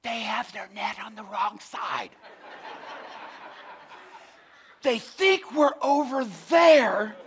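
conspiratorial little fish voices, hushed then gleeful; sneaky fish whispers. Pretend. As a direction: play the fish hiding and giggling. Preacher voicing fish under water.

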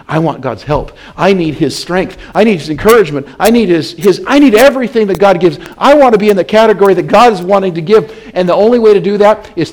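starts plain, climbs to loud and high; preaching, building to a shout. Acted. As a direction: build the plea until you're crying it out.

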